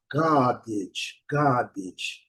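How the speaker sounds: "garbage" British style, long A and no R; British model pronunciation with silent R; British English. Shown as a demonstration. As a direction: say "garbage" as GAH-bidge, British. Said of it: "do inglês britânico é ga".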